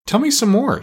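high, eager request; excited doctor, pitch jumping up; all excited. Acted. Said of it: "all excited".

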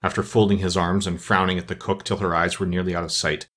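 plain reading voice

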